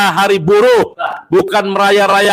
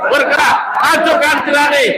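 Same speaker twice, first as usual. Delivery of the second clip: fiery revolutionary speech; 6 semitones higher than usual. Real, not acted. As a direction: shout the slogans to rouse the crowd. Rally slogans shouted at crowd.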